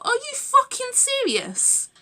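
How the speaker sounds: a stunned, drawn-out are you serious; incredulous. Retold or imitated. Disbelief at a tweet.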